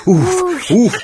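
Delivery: loud high strained puffs; puffing with effort. A narrator's reading. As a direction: huff it out under heavy strain. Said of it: "пыхтел Вовка".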